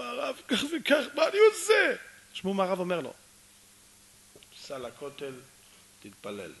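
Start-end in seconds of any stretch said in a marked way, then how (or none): 0.0-1.9 high, loud appeal for advice; acted desperate husband, pitched way up; desperate plea. Husband pleads with the rabbi. 4.6-6.5 low, soft, measured instruction; soft voice of authority; rabbi's quiet ruling. Acted. Rabbi gives his short answer.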